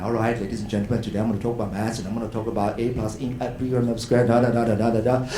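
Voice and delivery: low, droning monotone; monotone low lecture. Shown as a demonstration. A lecturer showing a dull low drone to students, monotone. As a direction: drone on like a boring maths teacher. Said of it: "So you're talking like this normally".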